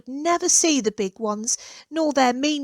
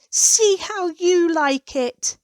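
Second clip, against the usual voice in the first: angry hiss; 6 semitones higher, slower than usual. A narrator's reading. Goose chasing the children who kicked her, hissing angrily. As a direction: spit the words out slowly in anger. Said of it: "she hissed angrily at them".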